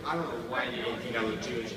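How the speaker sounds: ordinary talk, nothing marked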